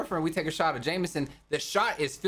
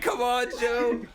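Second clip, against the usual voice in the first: mock nagging friend; 8 semitones higher, slower than usual. Marshall kicking him awake upstairs, hammed-up slow nagging. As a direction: drag it out like a pushy buddy.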